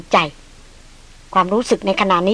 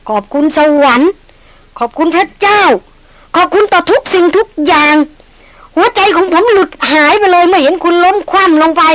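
relieved outpouring; 7 semitones higher, 4 dB louder than usual. Acted. Relieved outpouring after near shooting.

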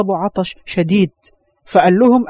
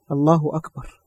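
choked up; 5 semitones lower, 8 dB quieter than usual. Real, not acted. Preacher overcome with emotion.

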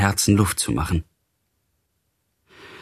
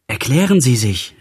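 raised-pitch, urgent demand; calling out; 5 semitones higher, 3 dB louder than usual. A narrator's reading. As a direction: cry it out, without a full shout. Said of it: "rief ich". (ausrufend, mit gehobener Stimme)

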